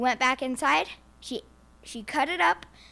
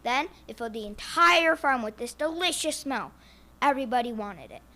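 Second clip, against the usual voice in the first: plain reading voice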